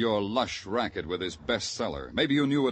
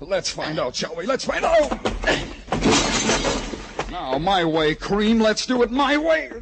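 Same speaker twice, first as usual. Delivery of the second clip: straining in a fight; 10 semitones higher, 6 dB louder than usual. Acted. Struggling back in fight.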